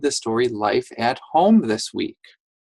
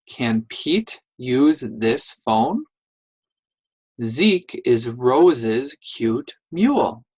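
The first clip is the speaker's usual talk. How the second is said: slow careful reading; slower than usual. Shown as a demonstration.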